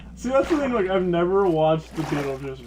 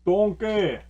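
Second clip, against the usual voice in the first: raised mock-ogre call; Shrek-style call; 5 semitones higher, 4 dB louder than usual. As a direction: call it out like a chef playing an ogre. Imitating Ramsay voicing Shrek.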